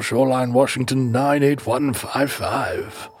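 mumbly mock British colonial accent; British big game hunter. Big game hunter giving the mailing address, British accent. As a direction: trail off like an old British hunter. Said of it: "you're a big game hunter".

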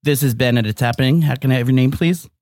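deep producer voice. Pretend. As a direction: answer like a radio producer. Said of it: "You're scaring people".

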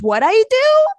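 high, loud Italian-American comeback; Italian-American outrage, half-shouted; angry Italian-American New Yorker; Italian-American snap. Retold or imitated. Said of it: "I found myself getting really Italian".

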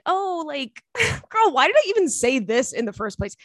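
high, bubbly chit-chat with a laugh; chatty girl voice. Retold or imitated. To a stranger in the elevator, bubbly chatty greeting. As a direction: play her own overly friendly small talk. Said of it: "So I said".

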